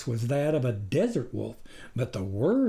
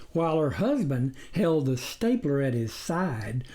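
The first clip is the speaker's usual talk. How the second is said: ordinary delivery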